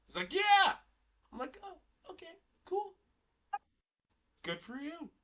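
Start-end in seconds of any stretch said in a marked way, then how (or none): 0.3-0.8 high, loud mock enthusiasm; loud, high retold yeah; imitated eager yes. Acting out Tyler's boastful answer. 1.6-5.1 high, soft mock-pleased reply; high little voice of pretend approval; feigned approval. Acting out own feigned approval.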